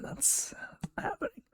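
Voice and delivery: soft, breathy under-the-breath repeat; whispered aside. Shown as a demonstration. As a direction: mutter it to yourself. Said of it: "repeated as aside".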